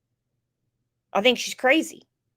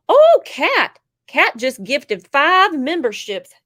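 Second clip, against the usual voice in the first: excited; 5 semitones higher, 8 dB louder, slower than usual. Real, not acted. Greeting a gifting viewer.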